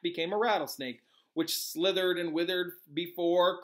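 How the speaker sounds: ordinary delivery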